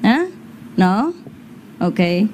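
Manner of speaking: usual speaking voice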